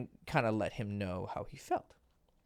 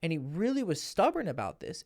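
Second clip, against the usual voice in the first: ordinary delivery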